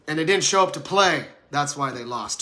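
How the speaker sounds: normal talking voice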